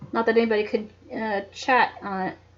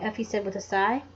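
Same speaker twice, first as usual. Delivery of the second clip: plain reading voice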